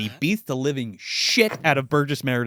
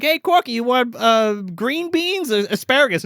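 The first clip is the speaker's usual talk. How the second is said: high, sing-out casual offer; pitched-up, oblivious cheer; Ann-Margret; 9 semitones higher, 4 dB louder than usual. Retold or imitated. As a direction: imitate a woman calling in with a dinner question. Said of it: "Ann-Margret comes out and she's like".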